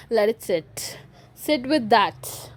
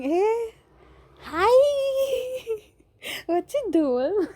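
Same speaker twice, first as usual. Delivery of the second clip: high teasing hi; mock sweet greeting; 11 semitones higher, much slower than usual. Greeting her brother if he's listening, a high teasing hello. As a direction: coo at him with a laugh.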